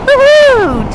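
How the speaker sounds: high, joyful woohoo; excited cheer. Real, not acted. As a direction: let out a happy whoop. Cheering after two thousand kilometers.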